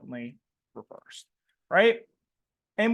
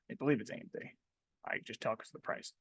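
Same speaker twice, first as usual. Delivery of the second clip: muttered under the breath, much softer and lower; quiet aside; 7 semitones lower, 12 dB quieter, faster than usual. Real, not acted. Trading teacher muttering to himself about the chart, hushed. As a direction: drop to a murmur meant for nobody.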